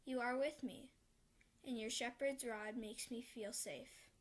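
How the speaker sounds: ordinary talk, nothing marked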